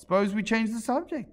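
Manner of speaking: high, stretched yawning interruption; bored March Hare voice. A narrator's reading. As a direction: suggest it through a yawn.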